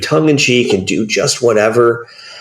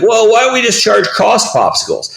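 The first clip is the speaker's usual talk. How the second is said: corporate guy; 13 semitones higher than usual. Retold or imitated. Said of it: "another guy's like".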